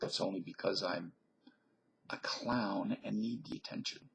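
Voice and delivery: unvoiced confessional whisper; hushed self-mocking aside. Real, not acted. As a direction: whisper it like a guilty secret.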